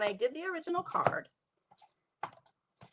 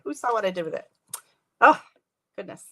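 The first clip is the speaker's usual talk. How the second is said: ordinary talk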